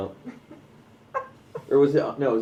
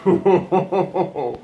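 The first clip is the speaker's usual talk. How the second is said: "Oh, no." laughed out, louder and higher; laughing dismay; 6 semitones higher, 7 dB louder than usual. Real, not acted. Friend watching election results, laughing dismay. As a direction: laugh through the words in dismay.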